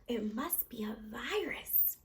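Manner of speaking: hushed, slow whisper; a doctor's whispered guess; whispered diagnosis. A narrator's reading. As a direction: breathe it out as a secret verdict. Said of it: "he announced with authority".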